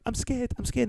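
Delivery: a small voice, lightly playing afraid; mock scared. Voicing Keira Knightley's character in bed.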